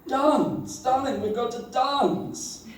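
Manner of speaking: high, grand luvvie voice; high hammed-up thespian; theatrical darling. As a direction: play the dramatic actress.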